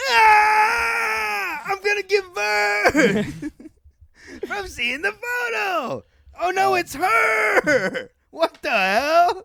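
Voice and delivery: high, slow wailing shrieks; hammed-up horrified shriek. Mock horror at ex photo.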